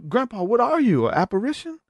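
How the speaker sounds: mock alarmed relative; shocked family. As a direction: gasp it out at a ghost. Family questioning grandpa.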